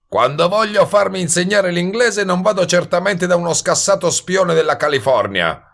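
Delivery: high, indignant hustler's retort; scornful wise guy. Acted. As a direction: throw back the insult in a high, cocky voice. Voices Goebel's scornful retort. (sbruffone sprezzante, voce acuta)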